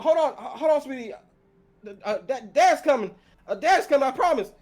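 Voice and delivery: high, loud, frantic comforting; a scared dad shouting comfort down the line; panicked reassurance. Acted. Old farmer calling to his daughter, frantic and loud.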